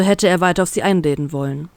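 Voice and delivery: usual speaking voice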